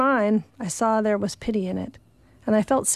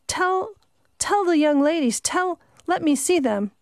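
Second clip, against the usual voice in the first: pitched up, pleading for her life; a frantic, higher plea at the door; desperate begging; 7 semitones higher than usual. Acted. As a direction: beg them to let you in. Begging to see the ladies.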